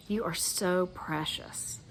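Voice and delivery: tender. Shown as a demonstration. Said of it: "So let's do that for you".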